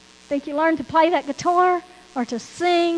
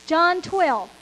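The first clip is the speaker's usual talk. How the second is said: normal talking voice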